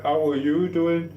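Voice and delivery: slow, high-pitched drawn-out question; effusive greeting. Real, not acted. Streamer asks after a returning viewer, high drawn-out greeting. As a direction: stretch it out high, rising at the end.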